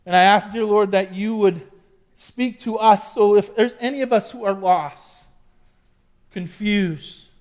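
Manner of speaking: hushed, reverent prayer. Acted. Pastor leads a prayer.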